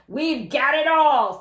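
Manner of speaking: loud, slow, gloating; gleeful burglar. A narrator's reading. Burglar gloating loudly to his partner over the loot. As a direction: savour the haul out loud. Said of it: "said the second burglar".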